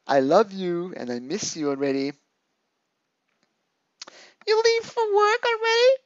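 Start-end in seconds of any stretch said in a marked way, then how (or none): 4.5-5.9 put-on high, whiny, needy girlfriend voice; clingy girlfriend. Girlfriend reading her needy messages aloud in a high whine. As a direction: plead like a lonely girlfriend in a high voice.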